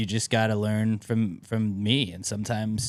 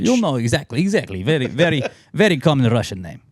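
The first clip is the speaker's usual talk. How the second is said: mock Russian accent; 4 semitones higher than usual. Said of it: "Hello, I'm Russian".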